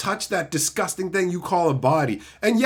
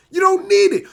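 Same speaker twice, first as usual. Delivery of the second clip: 1 angry shout; 13 semitones higher, 7 dB louder than usual. Real, not acted. Repeated angry insistence.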